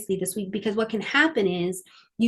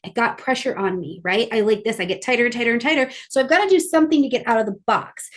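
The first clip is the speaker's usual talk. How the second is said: usual speaking voice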